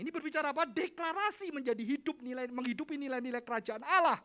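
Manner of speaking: loud, high declaration; full-force preaching. Acted. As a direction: proclaim it like a rallying declaration.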